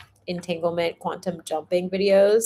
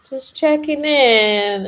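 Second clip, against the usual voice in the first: high, slow, sing-song check-in; sweet check-in; 6 semitones higher, 7 dB louder, much slower than usual. Retold or imitated. She texts a friend in a sugary voice. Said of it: "And I was like".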